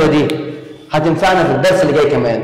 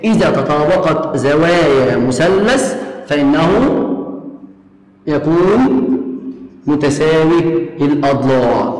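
slow dictation; much slower than usual. Shown as a demonstration. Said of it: "teacher dictating the rule".

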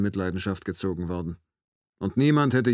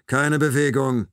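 loud, slow, raised order; loud command; 5 semitones higher, 6 dB louder, slower than usual. A narrator's reading. Knifeman orders the narrator to freeze, loud and commanding. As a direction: order it loudly and firmly. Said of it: "sagt er laut".

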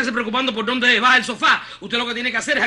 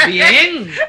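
surprised; 10 dB louder than usual. Acted. Disbelief at his calm reply.

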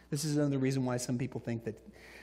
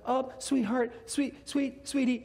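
high-pitched parental coaxing; sweet-talking a noisy child in church; flustered parents; 11 semitones higher, slower than usual. Retold or imitated. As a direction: imitate parents gently trying to hush a toddler. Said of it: "And the parents are like".

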